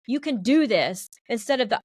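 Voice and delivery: ordinary delivery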